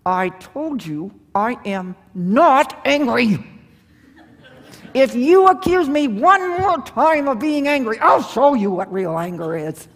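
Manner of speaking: simmering anger breaking through; denying anger angrily. Shown as a demonstration. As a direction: insist you're calm while fuming. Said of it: "how about this one".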